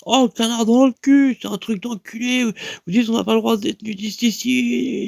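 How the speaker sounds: outraged rant. Retold or imitated. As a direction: rant like a drunk mate fed up with the bill. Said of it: "il a pas pu s'empêcher de dire".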